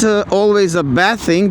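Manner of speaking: usual speaking voice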